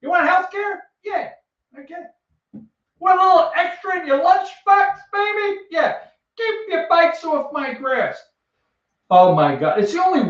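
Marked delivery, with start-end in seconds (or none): 0.0-6.0 mock coaxing old man. Sweet-talking the kids in role. 6.4-8.2 a lightly voiced grumpy old man; old neighbour warning kids off his grass, mildly cranky. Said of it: "yelling at kids".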